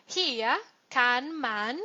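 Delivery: very slow, spaced German; slow teacher dictation; slow model sentence. Shown as a demonstration. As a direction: dictate Hier kann man word by word. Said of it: "model German sentence".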